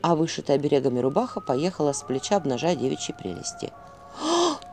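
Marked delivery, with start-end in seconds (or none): 4.2-4.6 high sleepy moan; moan in sleep. A narrator's reading. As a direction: moan it out in a dream. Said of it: "простонала во сне девица".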